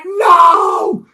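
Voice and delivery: high, loud cry of dismay; despairing shout. Retold or imitated. Director as the tree takes out the lights, high despairing shout. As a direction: yell as the power dies. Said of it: "And I'm like".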